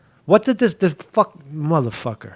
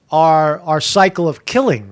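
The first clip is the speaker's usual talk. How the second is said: usual speaking voice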